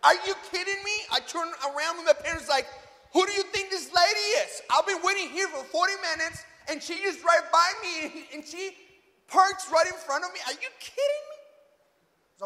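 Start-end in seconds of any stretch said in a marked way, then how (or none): 0.0-0.9 loud, high, incredulous "what"; outraged disbelief. Retold or imitated. Driver reacting to a lady cutting in front, incredulous. 3.1-11.4 high-pitched, worked-up complaint; indignant rant. Retold or imitated. Son complaining to his parents about the lady, indignant.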